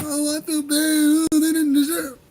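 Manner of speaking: high, slow sneering whine of fake pity; mock sympathy. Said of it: "They're like".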